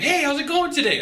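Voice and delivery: bright, upbeat hello to the clerk; cheerful hello at the desk; friendly greeting. Shown as a demonstration. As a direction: greet them like an old friend. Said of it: "I'm very friendly, very friendly".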